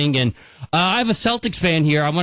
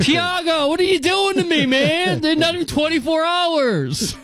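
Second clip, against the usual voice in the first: hammed-up wail, pitched way up; mock lament; 12 semitones higher than usual. Fan pleading with Tiago over the trade, mock anguished wail. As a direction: whine it to the team like a jilted fan.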